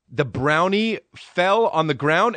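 usual speaking voice

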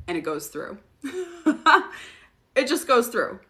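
normal talking voice

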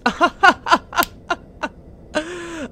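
high staccato laugh that trails off; joking laugh. Pretend. Player joking about her eyes, high staccato laugh. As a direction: laugh in high, separate bursts. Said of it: "just kidding".

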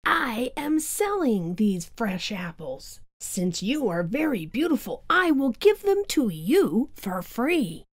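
lightly voiced old peddler lady. A narrator's reading. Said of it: "disguised as an old lady".

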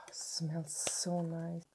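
slow, breathed-out whisper of pleasure; blissful whisper. Real, not acted. Vlogger smells the facial mist she just sprayed, blissful whisper. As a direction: sigh it out softly, savouring the scent.

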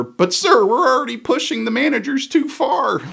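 timid underling pleading with the boss; concerned board member. Retold or imitated. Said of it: "Then you get the concerned board member".

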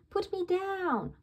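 put-on high, child's protest yell. A narrator's reading. Bingo yelling at Dad to put her down, high protest. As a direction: squeal to be let go. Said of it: "yells Bingo".

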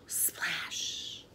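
whispery splash; sound effect. A narrator's reading. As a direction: make the word sound like water. Sound effect of ball in water.

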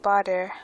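"bother" with a hard d for th; imitated accent slip. Player quoting own slip, "bother" said as "bodder". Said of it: "Where did that accent come from".

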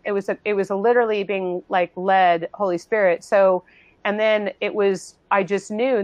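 ordinary talk

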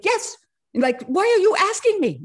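high, loud, challenging comeback; imitated indignant retort. Baba answers her doubt about moving it back, indignant. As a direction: throw the question back as the imitated teacher.

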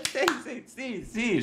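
high, soft little yeses; mimicked meek agreement. Streamer imitating Agostina's reply, soft high mimicry. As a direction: mimic her small, hushed agreeing. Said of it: "y Agostina".

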